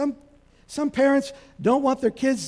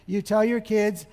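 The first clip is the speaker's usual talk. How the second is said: ordinary delivery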